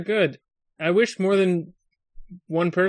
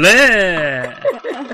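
vampire bleh; 5 semitones higher, 8 dB louder than usual. Pretend.

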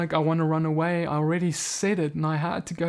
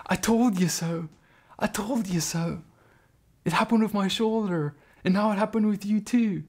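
imitated excited friend, rising pitch; 4 semitones higher than usual. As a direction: act out a thrilled I-told-you-so. Friend exclaims to healed man.

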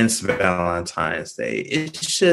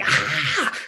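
high-pitched but restrained "Ah!"; mock fangirl scream; 7 semitones higher than usual. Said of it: "supposed to be screaming like".

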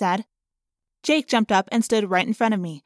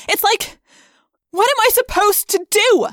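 high, loud, desperate outburst; yelling; 12 semitones higher, 8 dB louder than usual. A narrator's reading. Rachel overwhelmed among her friends, yelling high. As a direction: burst out in frustration. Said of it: "I yelled".

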